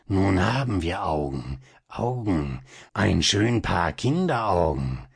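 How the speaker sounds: a mild hint of a sinister whisper, not really hushed; understated villain's gloating. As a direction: gloat over the child's eyes, lightly voiced. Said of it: "So flüsterte Coppelius".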